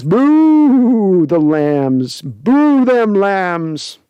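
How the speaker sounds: hugely stretched, swooping boo; drawn-out mock booing. Host reads out a fan's jeer at the Lambs, drawn-out booing. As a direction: jeer slowly, holding each boo long.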